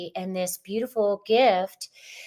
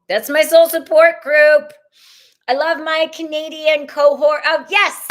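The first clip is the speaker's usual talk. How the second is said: excited cheer; 8 semitones higher, 10 dB louder than usual. Real, not acted. Cheering her Canadian viewers.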